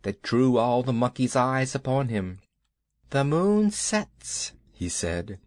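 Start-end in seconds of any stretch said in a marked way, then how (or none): 3.1-4.5 Kaa's slow, high, hypnotic voice; slow snake voice. Acted. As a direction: stretch each word like a coiling snake. Python Kaa speaks slowly.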